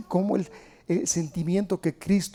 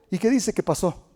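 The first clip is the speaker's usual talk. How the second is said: ordinary delivery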